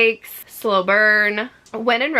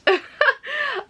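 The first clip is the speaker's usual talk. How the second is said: laugh. Real, not acted. Laughs at pink covers.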